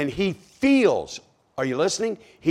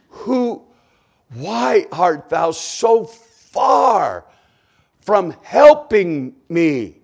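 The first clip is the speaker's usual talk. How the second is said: slow, drawn-out cry building to a huge roar; anguished roar; 4 semitones higher, 10 dB louder, slower than usual. Acted. Psalmist crying to God, a slow wounded roar. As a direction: bellow each word slowly like a wounded animal. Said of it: "this is the sound of a wounded animal".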